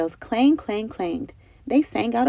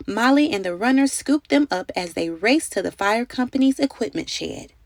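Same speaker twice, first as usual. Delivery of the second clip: usual speaking voice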